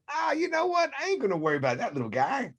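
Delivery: high, scoffing brush-off, then rushed; dismissive defender. Retold or imitated. Defensive back underrating the receiver, scoffing. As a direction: shrug off the little receiver. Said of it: "and he went".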